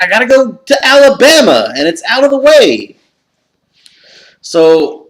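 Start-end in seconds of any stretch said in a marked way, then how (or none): 0.0-3.0 high, loud lament; retold despair. To his wife, a high loud lament about the trip. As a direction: moan that there's no way back. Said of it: "I was like".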